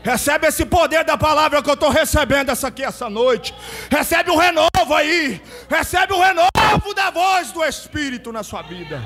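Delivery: brado profético, subindo ao agudo; pregação a plena força. Real, not acted. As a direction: profetize com fervor, quase cantado. (pregação pentecostal inflamada)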